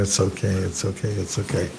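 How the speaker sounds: quiet, soothing it's okay; soft and comforting; soft reassurance. Retold or imitated. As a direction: murmur it gently to calm a crying friend. Comforting a crying godbrother.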